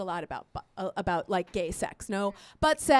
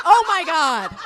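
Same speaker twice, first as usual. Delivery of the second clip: loud, higher mock horror; hammed-up shock; appalled exclamation; 5 semitones higher, 8 dB louder, slower than usual. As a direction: cry out in disbelief. Appalled at son's question.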